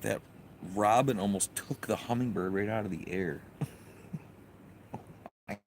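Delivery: usual speaking voice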